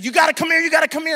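high, loud urgent calling; real panic scream. Retold or imitated. Wife screaming for husband about a spider in the shower. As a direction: yell for help in a fright. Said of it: "she is actually screaming".

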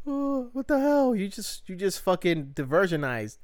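squeaky fake crying, half-joking; Brienne mock crying. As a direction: imitate a jilted woman crying, played for laughs. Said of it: "crying, like".

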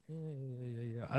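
barely audible yeahs, far away in thought; dazed murmur. Retold or imitated. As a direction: murmur it to yourself, lost in the moment. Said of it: "caught me in that moment of going".

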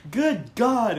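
loud, high imitation of an exasperated director; mock exasperated outburst. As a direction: boom it out, mimicking him.

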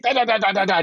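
light hammed-up French gibberish. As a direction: babble fake French at him. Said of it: "French gibberish".

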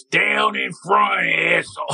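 Philly fan heckling from behind, mock slow yell; rude Philly fan yell. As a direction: drag out the heckle like an annoyed fan. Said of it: "a Philadelphia behind him goes".

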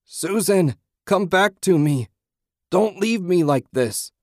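very high, loud screaming of her name; husband screaming after his lost wife; screaming plea. A narrator's reading. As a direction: scream for her to come back. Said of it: "Ted screamed".

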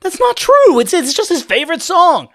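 put-on high, loud little boy voice; yelling kid defending himself; shrill child protest.

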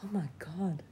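breathless unvoiced whisper of shock; hushed horrified whisper. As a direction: whisper it like you can't believe it. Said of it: "I'm like".

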